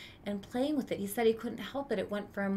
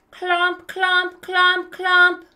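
higher, heavier clomps growing louder; marching boots; 8 semitones higher, 15 dB louder, much slower than usual. Acted. Boy mimicking soldiers marching past, heavy stomping clomps. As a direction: stamp each clomp like a boot.

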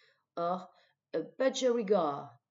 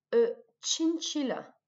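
slow, higher "chinchilla" for learners; slow model pronunciation; 5 semitones higher, 4 dB louder than usual. Shown as a demonstration. Teacher giving vocabulary to repeat, slow model pronunciation. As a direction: draw out "chinchilla" as a model to copy. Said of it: "de répéter le vocabulaire après moi".

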